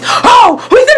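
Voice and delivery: high-pitched, garbled mimicry of ranting; mock aggressive bluster. Storyteller mimics the man coming up in her face, mock-angry. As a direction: imitate him blustering up in your face.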